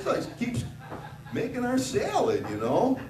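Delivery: usual speaking voice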